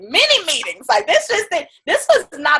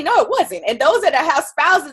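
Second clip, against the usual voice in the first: normal talking voice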